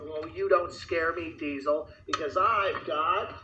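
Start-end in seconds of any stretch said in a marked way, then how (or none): none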